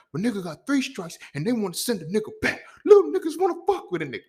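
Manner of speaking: high, strained aggrieved rant, rising to a loud cry; Melvin from Baby Boy. Retold or imitated. Quoting the Baby Boy scene he related to, strained high rant. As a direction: quote the movie's aggrieved old hothead. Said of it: "dude on Baby Boy, Melvin".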